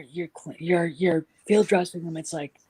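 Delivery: ordinary talk, nothing marked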